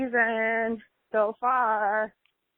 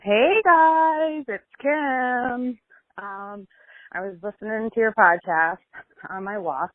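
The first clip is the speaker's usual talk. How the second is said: ordinary talk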